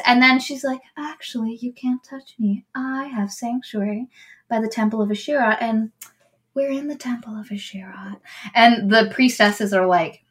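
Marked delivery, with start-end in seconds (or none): 1.0-8.2 smug hushed claim. Retold or imitated. Villain claiming sanctuary smugly.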